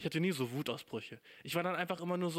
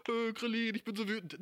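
mock tearful whine, pitched up; badly faked angry weeping; Son Goku; 6 semitones higher than usual. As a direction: whimper like a hero crying with rage. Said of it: "dass er einfach anfängt zu weinen".

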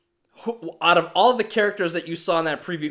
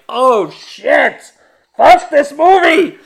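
high, loud, slow outburst; stressed shout; 10 semitones higher, 11 dB louder, much slower than usual. Retold or imitated. Watching the movie, a high loud stressed-out shout. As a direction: yell it high and slowly, fed up. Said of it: "And I'm like".

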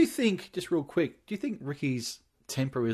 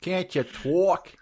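put-on New York accent; 7 semitones higher, much slower than usual. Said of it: "That is a thick New York accent there".

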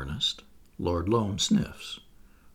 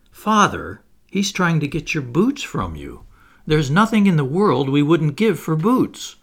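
louder, urgent warning to father; quick alarm; 6 semitones higher, 8 dB louder than usual. Acted. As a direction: blurt it out before he's fooled.

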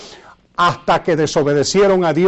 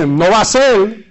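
ordinary talk, nothing marked

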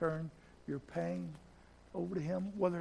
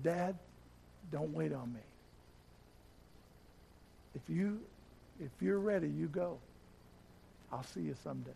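tender farewell; 7 dB quieter than usual. Retold or imitated. Son releases dying father.

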